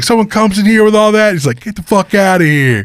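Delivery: high, hammed-up New England accent. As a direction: imitate an incredulous local in New England accent. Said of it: "people that have that accent".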